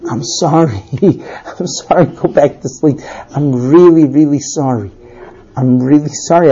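low-pitched, remorseful wife; apologetic pleading. Acted.

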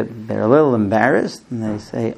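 ordinary delivery

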